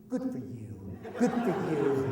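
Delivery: low, soft, patronising pat on the head; condescending praise. Retold or imitated. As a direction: praise them like a small child.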